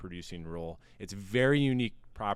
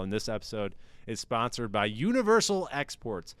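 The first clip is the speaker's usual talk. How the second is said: hammed-up radio ad voice, selling hard; mock ad read; 3 semitones higher than usual. Host reads a mock sponsor spot for his listeners, in a hammed-up ad voice. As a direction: pitch the fake spy front like a cheesy commercial.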